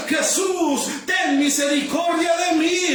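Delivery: high, full-voiced cry for mercy; desperate beggar's shout, acted by a preacher; shouting even harder. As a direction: scream the plea over a hushing crowd. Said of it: "él mucho más gritaba y decía".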